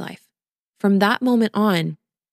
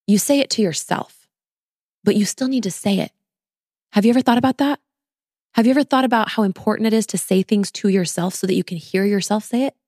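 ordinary talk

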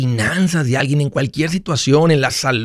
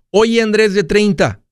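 light, raised call to a younger self; future self calling back; 7 semitones higher than usual. Acted. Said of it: "¿Qué le diría mi futuro yo".

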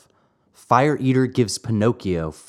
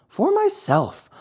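emphatic high insistence. Acted. Pinocchio insists.

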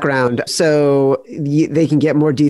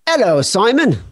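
put-on English greeting, pitched up; English accent; 6 semitones higher than usual. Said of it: "Since you're English, I'll give you this".

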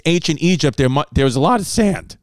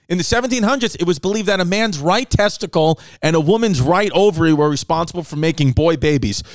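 usual speaking voice

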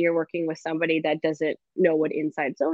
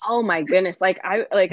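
usual speaking voice

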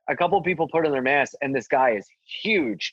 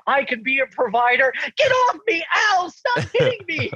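hammed-up panicked shriek; 14 semitones higher than usual. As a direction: shriek while pleading to girlfriend on phone. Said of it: "I'm on the phone, like".

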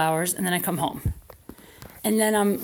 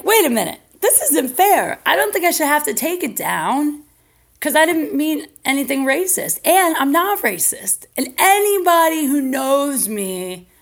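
a mock-whiny self defending herself; whiny protest; 5 semitones higher than usual. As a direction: plead your innocence. [name] protesting she's not racist.

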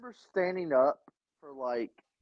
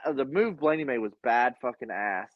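normal talking voice

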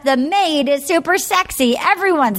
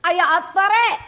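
put-on Scottish accent; 6 semitones higher than usual. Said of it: "do that Scottish accent".

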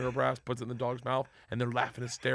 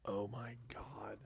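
slow, voiceless whisper of disbelief; appalled whisper; 3 semitones lower, 19 dB quieter, much slower than usual. Real, not acted. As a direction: whisper it in stunned horror. Listener appalled at the revelation.

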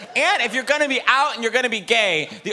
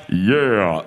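much lower, louder butch "Yeah."; macho voice in my head; 11 semitones lower, 4 dB louder than usual. Retold or imitated. Comic mocking how he imagines he sounds, low and butch. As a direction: drop deep like a tough straight guy. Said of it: "in my head, I sound like".

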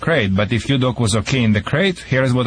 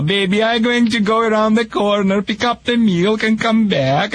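cooing to dog; 10 semitones higher than usual. Retold or imitated. Owner saying goodbye to dog, mock cooing baby talk. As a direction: coo at the dog like a doting owner.

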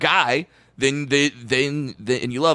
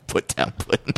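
laughing through the words; 3 dB quieter than usual. Real, not acted. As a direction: giggle it out. Laughing repeat.

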